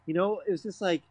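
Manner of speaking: usual speaking voice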